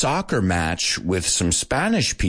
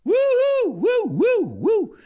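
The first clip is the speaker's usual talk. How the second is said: party woo cries; slower than usual. Shown as a demonstration. Host demonstrating the American crowd woo, high whoops. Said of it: "I'm referring to the woo".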